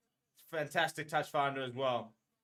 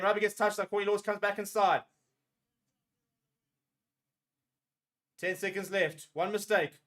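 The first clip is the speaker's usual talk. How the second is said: higher and louder running commentary; tense live calling; 4 semitones higher, 5 dB louder than usual. Real, not acted. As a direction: call every moment, on edge. Final seconds, tense live calling.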